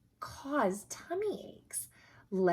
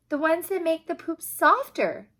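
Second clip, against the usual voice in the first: put-on higher and louder, a proud little boy; eager answer; 8 semitones higher, 9 dB louder than usual. The boy Ryan answering the doctor's quiz eagerly. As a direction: call the answer out like a keen pupil.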